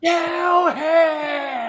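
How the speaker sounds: high, very slow drawn-out shout; stretched-out high yell; crowd shout. Pretend. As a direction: bellow it like an angry fan in the stands.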